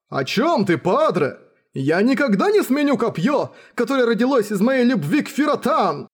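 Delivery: high, impassioned declaration; ardent, over-devoted suitor; lovestruck spear hero. Acted.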